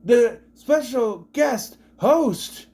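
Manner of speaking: high, very slow, drawn-out billing; grand hammed-up host introduction; mock announcer introduction. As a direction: announce it word by word with fanfare. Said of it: "takes the opportunity to introduce".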